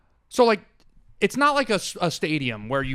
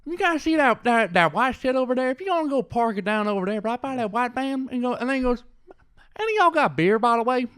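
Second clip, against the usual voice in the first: put-on much higher, louder Southern country accent; mock Southern accent; 10 semitones higher, 4 dB louder than usual. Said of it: "that is literally how they talk".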